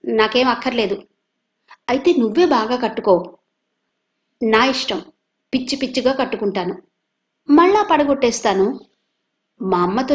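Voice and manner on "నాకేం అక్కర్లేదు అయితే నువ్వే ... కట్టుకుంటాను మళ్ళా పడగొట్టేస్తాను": put-on lightly raised bratty child quarrel; a hint of a child squabbling over toys; child arguing. Boy taunting his cousin in a quarrel, stubborn and bratty.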